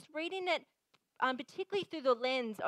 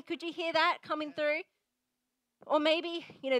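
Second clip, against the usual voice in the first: normal talking voice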